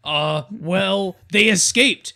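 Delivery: very high, loud taunting chant; prison guard. Acted. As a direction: jeer it like a schoolyard bully. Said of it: "the guard's like".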